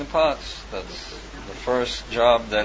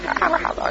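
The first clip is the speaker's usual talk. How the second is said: high laugh. Real, not acted. Laughing after the imitation.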